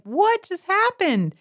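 put-on high, slow bewildered kid voice; baffled little kid. Child after watching mother lie, high and slowly bewildered. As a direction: ask it like a stunned small child. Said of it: "They just like".

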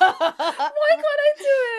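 high, loud and slow through laughter; laughing wail. Real, not acted. Host laughs at her own failed accent, wailing. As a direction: wail it while cracking up.

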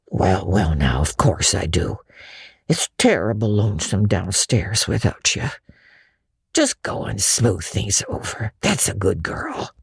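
put-on coaxing a girl to give in; shy old Matthew. A narrator's reading.

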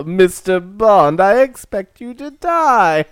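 slow, high, menacing Bond villain voice; unhinged villain. Pretend. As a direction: deliver it like a gloating supervillain. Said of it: "Oh, that was pretty good".

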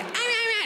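high, light yips like a skinny dog; little dog yapping. Retold or imitated. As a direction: yap like the skinny dog used to. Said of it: "It used to be".

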